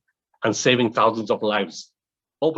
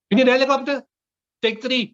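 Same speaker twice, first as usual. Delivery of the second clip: eager reply; 12 semitones higher, 4 dB louder, faster than usual. Retold or imitated. General answers eagerly in retold dialogue.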